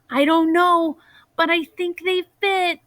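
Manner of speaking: barely containing his joy; breathless excitement. Acted. Boy squeezing into new shoes.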